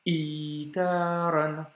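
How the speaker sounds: slow "itaran" with long vowels; blocked i, long i. Shown as a demonstration. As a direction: start "itaran" on a caught i and hold it. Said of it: "un petit blocage juste avant et ensuite le i long".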